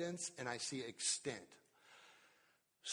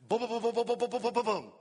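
rattling off words; 7 semitones higher, 9 dB louder, faster than usual. Retold or imitated. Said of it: "would you please read that list".

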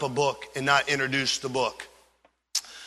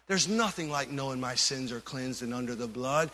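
much softer, intimate and sober; hushed sincere appeal; 2 semitones lower, 6 dB quieter than usual. Acted. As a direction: pull the congregation in close, quietly.

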